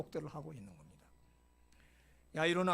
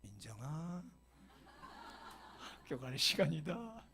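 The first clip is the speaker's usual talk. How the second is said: tender wake-up call; slower than usual. Shown as a demonstration. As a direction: coax her awake gently, no raising the voice. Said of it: "이렇게".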